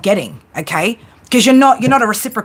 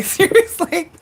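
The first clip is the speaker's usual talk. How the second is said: laughing; 8 semitones higher, slower than usual. Real, not acted. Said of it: "making me laugh today".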